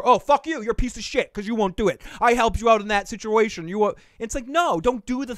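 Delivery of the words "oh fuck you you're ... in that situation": much higher and louder, mocking the ungrateful rant; hammed-up furious friend; imitating an angry friend who calls in a favor. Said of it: "Ask me to do a favor".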